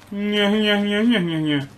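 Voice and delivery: high, loud playful nyeh-nyeh; teasing nyeh chant. Pretend. Player luring a cow with wheat, taunting nyeh chant.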